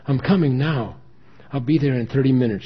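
urgent promise. A narrator's reading. Daughter urgently announces she is coming.

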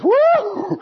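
high, loud woo of a thrilled eaglet. Retold or imitated. Eaglet whooping on his mother's back as she takes off. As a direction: whoop like a kid on a ride. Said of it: "And he goes".

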